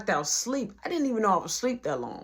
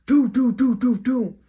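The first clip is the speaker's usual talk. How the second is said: loud, high, evenly punched knocking noise; imitated knocking; 3 semitones higher, 6 dB louder than usual. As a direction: imitate a hard knock on the door. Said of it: "all you hear is".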